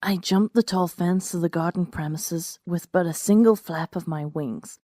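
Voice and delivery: ordinary delivery, nothing marked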